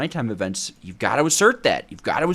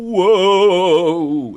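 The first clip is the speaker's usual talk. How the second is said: big surprised whoa, louder; 8 semitones higher, 10 dB louder than usual. Real, not acted. Streamer amazed at redstone multiplying, loud whoa. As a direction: gasp it out in amazement.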